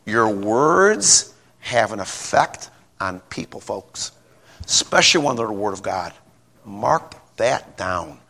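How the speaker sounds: low, soft and slow; earnest quiet warning. Real, not acted. Preacher pressing the lesson on his folks, soft and slow. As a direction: draw the congregation in, hushed. Sermon point after the story.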